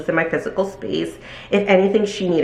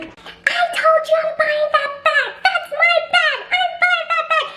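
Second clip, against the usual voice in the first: high, whiny mock customer voice; mocking irate customer. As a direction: mimic the pushy shopper, much higher. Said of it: "she's like".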